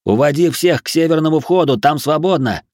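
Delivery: high, forceful call; called-out instruction. A narrator's reading. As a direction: shout directions across a crowd. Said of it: "выкрикнул я".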